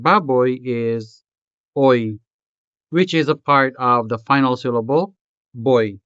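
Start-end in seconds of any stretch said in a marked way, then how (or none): none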